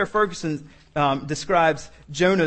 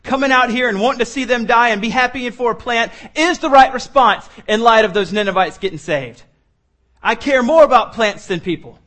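loud, higher-pitched defiant protest; petulant tantrum; 5 semitones higher, 6 dB louder than usual. Acted. Jonah justifying himself to God, loud childish defiance. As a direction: insist loudly like an indignant child. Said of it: "Spiritual infantile regression".

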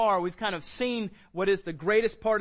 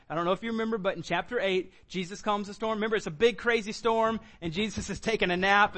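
ordinary delivery

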